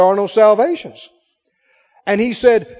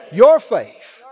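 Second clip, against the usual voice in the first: Your faith, louder with Your hit; stress on your; 6 dB louder than usual. Shown as a demonstration. Preacher leading the church, Your stressed loudly. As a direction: call out Your faith, leaning on Your. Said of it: "say it out loud".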